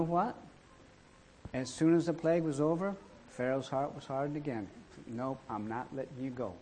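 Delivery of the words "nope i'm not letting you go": low, soft refusal; stubborn Pharaoh. Acted. Pharaoh turning down Moses once more, low and stubborn. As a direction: refuse quietly, dug in.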